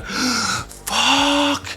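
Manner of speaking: gasp, then a high shrieked curse; sharp frightened yelp after a gasp; panicked gasp and curse. Retold or imitated. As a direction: jolt awake in terror and cry out. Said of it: "just freaking out".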